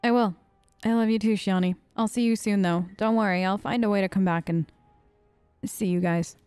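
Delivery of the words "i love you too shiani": usual speaking voice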